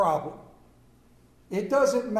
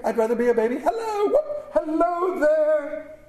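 much higher, breaking into laughter and song; laughing whoop; 8 semitones higher, 3 dB louder than usual. Acted. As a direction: crow with delight. Scrooge whooping with joy.

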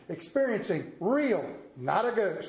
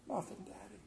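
put-on soft, quiet little-boy answer; a hint of a hurt child mumbling; small sad voice; 15 dB quieter than usual. Sad son answers father.

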